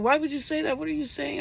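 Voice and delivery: high, incredulous questioning; shocked retold question. Friend shocked at news of death.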